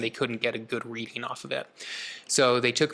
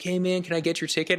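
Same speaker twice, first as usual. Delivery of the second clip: higher-pitched casual valet voice; valet attendant; 6 semitones higher than usual. Retold or imitated. Valet greeting an arriving driver, lightly imitated higher voice.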